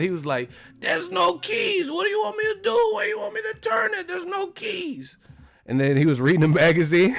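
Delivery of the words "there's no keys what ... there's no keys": very high, strained mock-angry voice; hammed-up high-pitched protest. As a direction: squeeze out a frantic, exasperated impression. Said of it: "[name] started getting mad. He was like".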